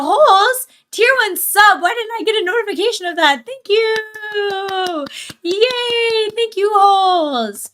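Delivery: high, loud, delighted squeal; excited thanks. Real, not acted. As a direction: gush over the surprise sub. Surprise sub thanks.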